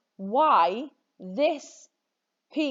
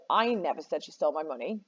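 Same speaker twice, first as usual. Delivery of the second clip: "I" stressed, the rest following lightly; 4 semitones lower, faster than usual. Shown as a demonstration. Teacher shows students how meaning shifts, stressing "I". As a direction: lean on the opening "I". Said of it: "where on earth you put the emphasis".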